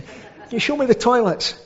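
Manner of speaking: high-pitched imitation of a blind old lady; lightly mocking, high old-woman impression; old church lady. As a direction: put on a high old lady's voice, playfully. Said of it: "She'd say".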